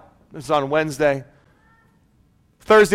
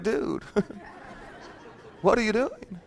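dazed; 2 semitones higher, 13 dB quieter, slower than usual. Retold or imitated. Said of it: "and I'm just like".

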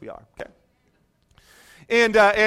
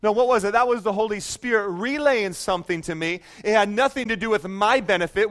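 ordinary talk

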